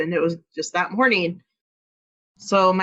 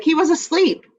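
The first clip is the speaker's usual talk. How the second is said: high, louder indignant retort; indignant protest; 8 semitones higher, 4 dB louder than usual. Retold or imitated. Mother protesting to the officer, high indignant retort. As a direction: shoot back in disbelief.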